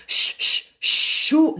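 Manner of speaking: loud hissed sh into shoo; loud shushing. Shown as a demonstration. Coach hisses the sh loudly. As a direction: push the sh hard. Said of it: "that S becomes a sh sound".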